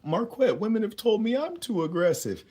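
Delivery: high, loud put-on voice; mock complainer. Viewer complaining to the host about women, mock high-pitched voice. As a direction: whine it out high as a caricature. Voicing a follower's complaint to himself.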